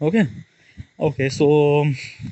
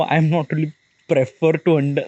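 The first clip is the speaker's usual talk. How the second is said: ordinary talk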